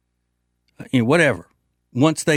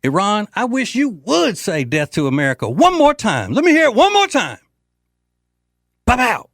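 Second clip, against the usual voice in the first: taunting yell; 9 semitones higher than usual. Retold or imitated. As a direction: yell the challenge, egging them on. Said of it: "if I were Trump, that's what I'd be saying".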